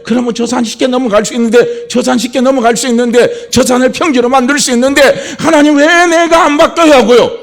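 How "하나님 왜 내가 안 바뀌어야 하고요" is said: loud, high lament to God; lamenting prayer. Pretend. Believer cries out to God in mourning, loud lament. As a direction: cry out in grief to God. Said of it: "애통하면서 우시는 거예요".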